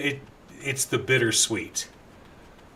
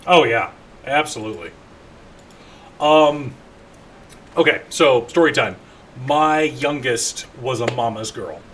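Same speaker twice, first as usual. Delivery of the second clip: usual speaking voice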